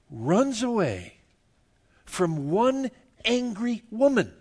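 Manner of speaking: high, loud, slowed disbelief; incredulous preaching. Acted. Preacher mocking Elijah fleeing one woman, incredulous. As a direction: marvel at the prophet running.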